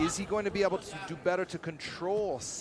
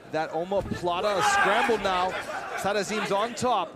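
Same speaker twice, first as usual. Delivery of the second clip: rising excitement, louder and higher; excited; 5 semitones higher, 6 dB louder than usual. Real, not acted. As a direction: call the scramble with building energy. Commentator reacts to a scramble.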